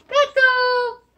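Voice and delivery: high, loud answer; excited reveal. Shown as a demonstration. Teacher revealing the horse word, high and loud. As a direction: ring out the answer.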